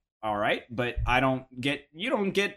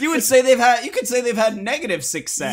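trailer voiceover; 10 semitones higher, 7 dB louder than usual. Acted.

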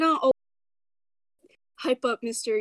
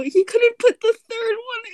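dismayed cry; 8 semitones higher than usual. Real, not acted. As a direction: wail in disbelief. Dismay at video.

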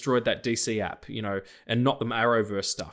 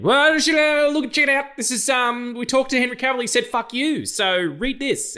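much higher, louder smarmy studio exec pitch; mock studio spin; 12 semitones higher, 4 dB louder than usual. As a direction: sell the news like a sleazy PR man. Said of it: "Everyone's like".

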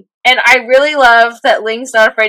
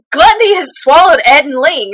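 ordinary talk, nothing marked

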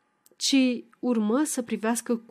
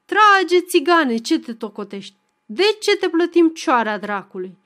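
bossy shout, much higher and louder; commanding shout; 6 semitones higher, 7 dB louder than usual. A narrator's reading. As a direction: bark orders at the fiddler. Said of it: "strigă poruncitor".

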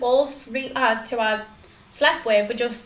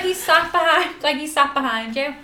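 louder, higher, emphatic warning; loud insistent repeat; 3 semitones higher, 3 dB louder than usual. Retold or imitated. Repeating the warning to a friend on the bus, loudly. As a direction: push it out louder so it gets through. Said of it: "Your friend is super loud".

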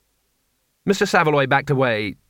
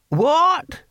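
put-on high, piping old-man query; high-pitched baffled old codger; hard-of-hearing old man. A narrator's reading. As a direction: squawk it out, not having heard. Deaf old Hamish interrupts.

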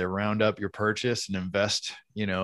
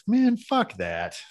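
exasperated; 11 semitones higher, much slower than usual. Real, not acted. Complaining about paying extra.

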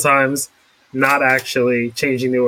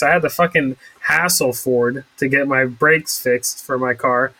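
ordinary delivery

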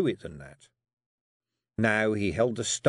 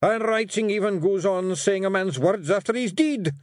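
growling Rob; 11 semitones higher, 4 dB louder than usual. Acted. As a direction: growl out your disgust at letters. Rob's voice.

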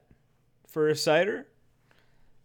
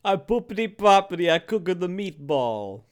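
high, loud cartoon Italian accent; badly faked, bouncy high Italian accent; horrible Italian accent; 6 semitones higher, 3 dB louder than usual. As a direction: go full hammed-up Italian caricature. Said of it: "horrible Italian accent".

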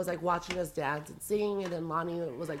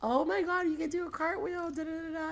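put-on high, gushing girlfriend voice, much higher; excited girlfriend; 10 semitones higher than usual. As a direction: imitate an amazed friend, pitched way up. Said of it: "and my girlfriend's like".

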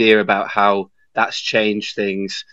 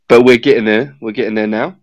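ordinary talk

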